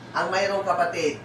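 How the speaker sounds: ordinary talk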